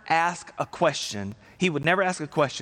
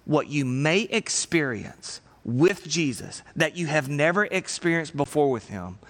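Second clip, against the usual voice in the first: ordinary talk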